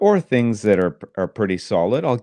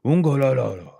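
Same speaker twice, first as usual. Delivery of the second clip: high, loud mock Japanese dad; imitating the dad; 5 semitones higher, 3 dB louder than usual. Imitating the dad from a Japanese TV show, mock grunts. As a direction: ham up the sitcom dad's grumbles. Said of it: "And the dad is always like".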